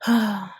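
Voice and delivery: a short heavy sigh; a sad little sigh before confessing. Acted. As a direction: let out one weary sigh. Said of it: "Dash sighed".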